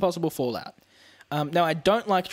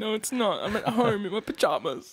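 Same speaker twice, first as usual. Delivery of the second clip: high-pitched playful whine; mock dismay; 7 semitones higher than usual. Co-host joins in answering the calendar reminder, high mock whine. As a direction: lament it in a high, put-on voice.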